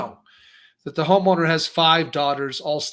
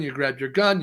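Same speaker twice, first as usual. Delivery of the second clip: ordinary delivery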